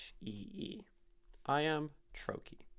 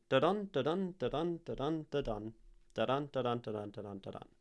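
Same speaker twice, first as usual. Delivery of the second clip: bouncy "da-dun" beat, stepping down in pitch as it goes; bouncing iambic rhythm; faster than usual. Shown as a demonstration. Teacher sounding out the meter of a line, bouncy "da-dun" chant. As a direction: chant "da-dun" in pairs, light "da", weighted "dun". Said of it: "It has, like, a bounce".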